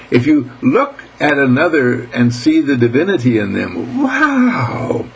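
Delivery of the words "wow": high, drawn-out wow of wonder; awed wow. Real, not acted. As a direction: let a big wow soar up high. Exclaims in wonder mid-talk.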